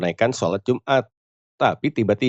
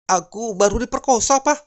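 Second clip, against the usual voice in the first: high, mock-tearful voice; plaintive high pitch, lightly acted weeping. Wife phoning husband at the office, mock tearful. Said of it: "Sambil menangis".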